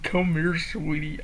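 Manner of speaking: soft, gentle coaxing; calm and sweet. Acted. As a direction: coax the chicken tenderly. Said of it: "She kneels down calmly saying".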